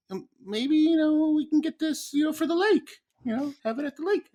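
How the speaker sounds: put-on very high, hopeful child asking; high kid voice. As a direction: sweetly ask like a little boy. Son voiced asking for kayak.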